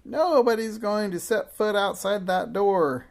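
high, loud witch's screech; screaming. A narrator's reading. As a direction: shriek it as a furious order. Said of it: "screamed Madame Snickesnee".

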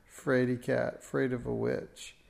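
plain reading voice

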